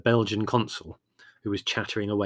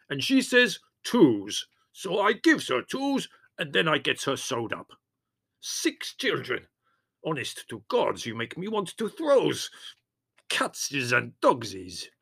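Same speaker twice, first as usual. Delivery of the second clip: put-on gruff Greek accent, slow and emphatic; loud Greek voice; 5 semitones higher, slower than usual. A narrator's reading. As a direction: hold forth in heavy Greek accent. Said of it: "said Spiro in a loud voice".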